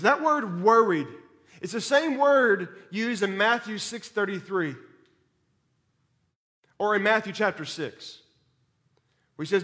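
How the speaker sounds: ordinary talk, nothing marked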